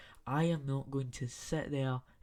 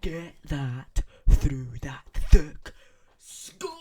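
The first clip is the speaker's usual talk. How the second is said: Ryan Reynolds impression; 15 dB louder, much slower than usual. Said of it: "as Ryan Reynolds would say".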